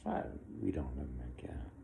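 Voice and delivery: quick whispered aside. Real, not acted.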